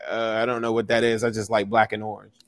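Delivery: low, soft, indifferent mumbler; imitating clueless stranger. Non-fan explaining borrowed team gear, low and clueless. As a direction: play someone who has no idea, low and soft. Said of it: "they're like".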